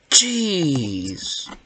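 ordinary talk

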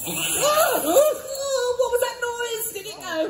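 high, sudden hammed-up alarm at a noise; startled panto alarm. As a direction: jump at the noise, big and comic.

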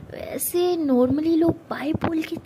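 slow, breathy teasing whisper. Acted. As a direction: tease her in a slow hushed whisper. (धीमी, फुसफुसाती छेड़छाड़)

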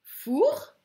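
teacher modelling the syllable four; clearly sounded out. Shown as a demonstration. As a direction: pronounce four loud and clear. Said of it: "Là, c'est".

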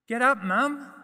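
high, loud yell at mum to get up; a child's fed-up, loud demand; frustrated yell. A narrator's reading. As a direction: shout her out of bed.

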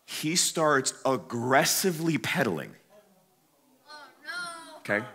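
ordinary delivery

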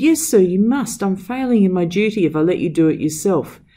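high, loud insistence; insistent. A narrator's reading. Servant insisting to his master about his duty, loud insistence. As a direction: push back hard with duty.

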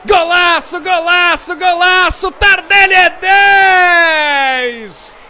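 long held goal shout; goal scream. Real, not acted. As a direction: roar the goal, drawn out. Goal call and celebration.

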